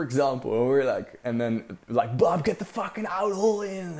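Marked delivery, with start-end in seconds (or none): 2.2-4.0 high-pitched mock order; imitating shouting skipper. Skipper shouting at Bob to get the outhaul in, mock yell. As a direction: play the frantic skipper barking at Bob.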